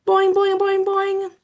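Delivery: high, bouncy boing; boing sounds. Acted. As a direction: bounce each boing like a springy frog.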